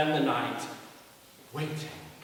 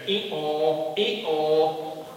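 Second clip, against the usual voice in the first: long, sung-out "ee-aw"; playful spoken hee-haw; donkey bray; 4 dB louder than usual. Acted. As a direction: bray along as the storybook donkey. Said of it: "with the donkey going".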